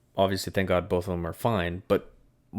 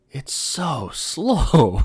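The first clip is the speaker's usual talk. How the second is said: very slow, higher mock complaint; imitating dad; 8 semitones higher, 4 dB louder, much slower than usual. Son mimicking dad calling the car slow, slow and high. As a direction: drag it out like a disappointed dad. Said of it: "He's like".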